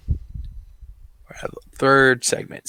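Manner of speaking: ordinary talk, nothing marked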